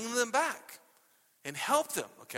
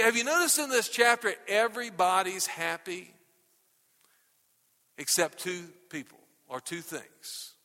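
ordinary talk, nothing marked

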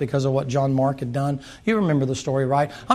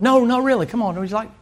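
acted pleading; 6 semitones higher than usual. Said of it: "has to be begged to come to dinner".

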